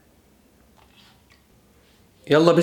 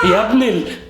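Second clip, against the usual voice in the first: startled outburst, higher pitch, cut short; 6 semitones higher than usual. Acted. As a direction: jump in fright and scold the prankster. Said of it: "اتخضيت".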